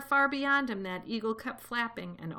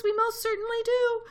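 very high, loud little squeak of a voice; piping tiny voice; 14 semitones higher, 7 dB louder than usual. A narrator's reading. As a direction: pipe up as a tiny person. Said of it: "piped the voice".

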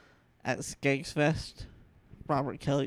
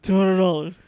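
loud excited shout of a bet; excited bid; 5 semitones higher, 13 dB louder than usual. Retold or imitated. Intern raising the bet on a dare, loud excited shout. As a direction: yell the bid, hyped. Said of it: "just that excitement".

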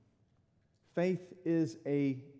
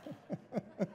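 laugh; 8 semitones higher, 8 dB quieter than usual. Real, not acted. Laughing at own joke.

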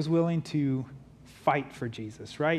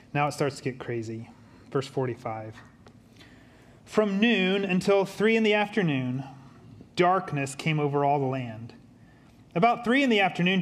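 ordinary talk

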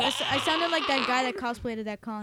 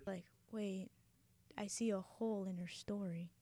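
whisper; 6 semitones lower, 15 dB quieter than usual. Pretend. Whispered aside.

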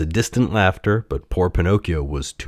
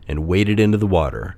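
ordinary delivery

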